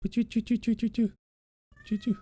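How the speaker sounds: high soft choo-choo noises; playful train chuffing, up high; cooing choo choo at a pet; train choo sounds. Pretend.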